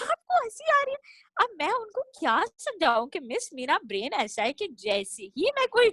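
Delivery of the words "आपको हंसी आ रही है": much higher, strained, cracking retold outburst; mock the teacher losing it; outraged teacher. Teacher flips at a smiling pupil, high-pitched outraged. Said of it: "she flipped".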